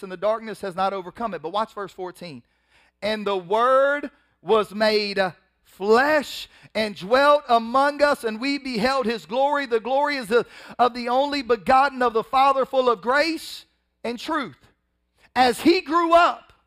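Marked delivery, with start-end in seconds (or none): 3.0-14.6 slow, loud, raised preaching of the verse; preaching scripture. Acted. Preacher to the congregation, slow loud proclamation. 15.3-16.5 preaching peak. Acted.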